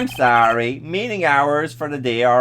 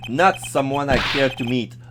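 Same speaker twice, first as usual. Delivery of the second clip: ordinary talk, nothing marked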